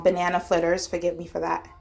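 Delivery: ordinary talk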